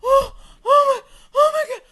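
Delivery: high, loud broken outcries; panicked gasps. Real, not acted. Reacting to the spider in the house, panicked high gasps. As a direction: gasp it out in rising panic.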